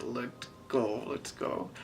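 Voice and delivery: low breathy hurry, afraid to be heard; hushed urgent whisper. Retold or imitated. In the dark building, whispering to friends to go. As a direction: whisper it, urging friends to run.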